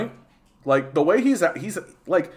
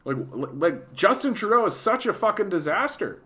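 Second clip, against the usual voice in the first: imitating a confused conservative griping; mock conservative voice; 6 semitones higher than usual. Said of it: "conservatives who are like".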